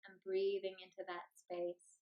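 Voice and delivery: unhurried meditation voice with long pauses; guided meditation. Acted. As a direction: lead them gently into stillness, phrase by phrase.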